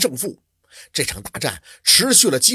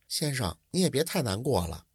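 softer, timid words; cautious and quiet; 3 semitones lower, 8 dB quieter than usual. A narrator's reading. Maid gently comforts the grieving scholar, softly and cautiously. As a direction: tread carefully, speak softly to him. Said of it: "便小心翼翼地说".

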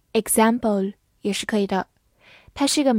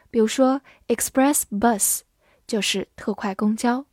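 ordinary talk

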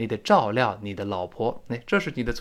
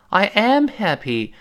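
am stressed, said slowly; 7 semitones higher, slower than usual. Shown as a demonstration. Teacher showing the stress on am. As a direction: lean on am. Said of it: "这个am在这里重读了".

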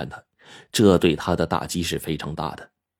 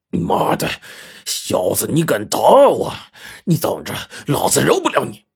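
humiliated rage; 6 semitones higher than usual. A narrator's reading. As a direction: curse him, swelling on the threat. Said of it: "恼羞成怒的就骂道".

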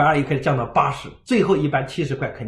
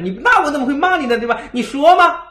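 higher, louder, all cheeky grin; 10 semitones higher, 5 dB louder than usual. Retold or imitated. To the shopkeeper, grinning, higher and louder cheeky coaxing. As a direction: coax with a teasing smile, climbing up. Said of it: "我就嬉皮笑脸跟他说".

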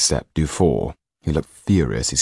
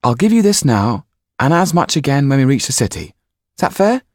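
put-on a boy's higher, louder bargaining voice; Jan bargaining; 9 semitones higher, 5 dB louder than usual. Jan offers Dufour coins, eager higher voice. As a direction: tempt him, pitched up.

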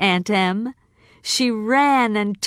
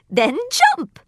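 high, light fairy-witch voice; good witch; 9 semitones higher than usual. Acted. Glinda greeting Dorothy, high and gentle. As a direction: float it up high and kind.

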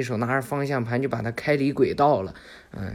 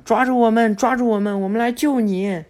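high, loud call from afar; calling out for help; 9 semitones higher, 4 dB louder than usual. Acted. Three boys yelling to Boruto from behind, loud calls. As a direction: shout to the train ahead. Boys shouting from rear car.

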